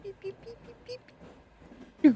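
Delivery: high, very soft beeping; tiny beeps. Pretend. Bird player beeping to themself, high soft beeps. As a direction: make little high beep noises.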